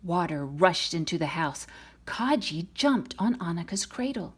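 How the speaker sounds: plain reading voice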